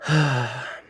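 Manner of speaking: a long audible sigh out; demonstration sigh. Shown as a demonstration. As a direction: breathe out a big sigh for the class. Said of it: "Give me a good sigh".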